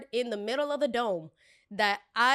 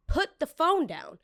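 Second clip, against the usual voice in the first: slow, high, emphatic order; stern command; 8 semitones higher, slower than usual. Retold or imitated. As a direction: space it out as a final warning. Orders guest to stop recording.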